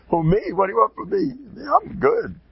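mock innocence. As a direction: play dumb, voice jumping up. Rebbe plays innocent after praise.

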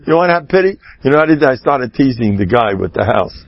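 normal talking voice

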